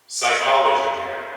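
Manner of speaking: booming hero title; superhero announcer voice. Pretend.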